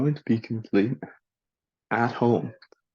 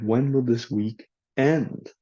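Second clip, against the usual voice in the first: elongated vowel and more emphasis on "end"; stress on END. Shown as a demonstration. Teacher shows a non-compound for learners, long stressed "end". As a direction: split "week end", leaning on "end". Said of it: "Elongated vowel, more emphasis".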